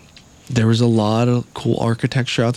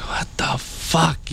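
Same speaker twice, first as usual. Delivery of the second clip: slow unvoiced whisper; hushed stunned whisper; 3 semitones higher, 6 dB quieter, much slower than usual. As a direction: breathe it out, dazed and hungover. Hungover on the plane.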